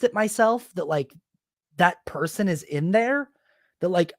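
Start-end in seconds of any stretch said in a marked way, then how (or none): none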